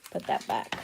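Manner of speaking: a low whispered aside to herself; whispered, as if only to herself. Real, not acted. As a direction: breathe it under your breath.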